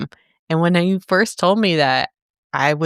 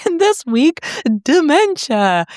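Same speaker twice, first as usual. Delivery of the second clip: high, projected mock stage introduction; slow, drawn-out reveal of the show's title; theater announcer; 11 semitones higher, 4 dB louder than usual. Host imagining a theater stage show, mock announcer. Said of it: "I just imagine, like, a theater stage".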